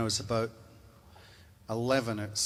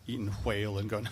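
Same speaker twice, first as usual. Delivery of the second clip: ordinary talk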